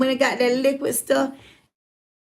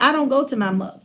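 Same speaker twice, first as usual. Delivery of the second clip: ordinary delivery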